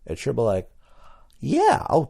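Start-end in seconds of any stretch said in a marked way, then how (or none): 1.4-2.0 high, louder fake-eager yeah; mock gushing saleswoman. Clerk humoring a hopeful cologne buyer, fake-eager gush.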